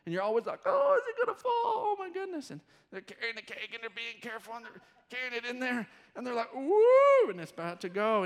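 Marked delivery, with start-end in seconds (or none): 0.7-2.5 high, anxious oh my goodness; hammed-up onlooker panic, pitched high; mock nervous worry. Onlookers fearing the cake falls. 6.5-7.3 high loud startled woo; mock panicked cry, high and loud; alarmed woo. Cake nearly falling.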